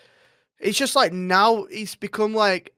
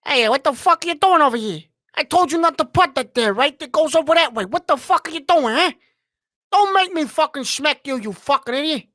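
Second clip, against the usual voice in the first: high-pitched, aggressive gangster rant; loud, high, lay into them aggressively; Lorenzo Fontana; 7 semitones higher, 5 dB louder than usual. Acted. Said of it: "it was high-pitched and more, like, aggressive".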